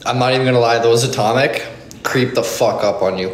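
ordinary talk

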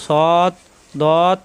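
"সদ দদ" each drawn out; four-alif stretch. Shown as a demonstration. Teacher naming two long letters, drawn-out stretch. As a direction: stretch both "সদ" and "দদ". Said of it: "চারালিপ পরিমাণ টান হবে".

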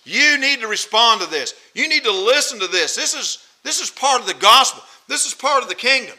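forceful preacher's insistence; emphatic. Acted. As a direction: press each sentence home to the listeners. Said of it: "he emphatically saying".

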